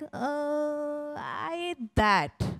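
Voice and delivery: put-on small hesitant child's voice, slowed way down; timid, drawn-out little admission; sheepish confession. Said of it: "And I'm like".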